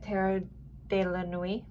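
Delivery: careful attempt at French pronunciation. Shown as a demonstration. Reader daring to say the deck's name, attempted French accent. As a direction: try the deck name in tentative French. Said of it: "dare I try to say it".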